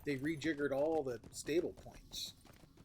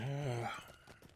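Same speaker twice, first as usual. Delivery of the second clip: big yawn; 3 semitones higher than usual. Real, not acted. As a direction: let out a long yawn. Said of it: "Sorry, old man yawning".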